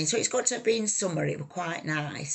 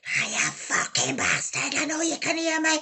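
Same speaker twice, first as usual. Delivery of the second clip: retold angry shout; 10 semitones higher than usual. Harry shouting at her back.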